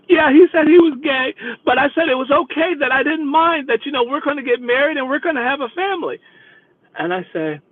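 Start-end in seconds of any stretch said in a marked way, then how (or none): 0.1-6.2 high, breathless run of protest; mock weepy insistence. Passenger defending her marriage plans, mock weepy insistence. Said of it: "she is boo-hooing back there".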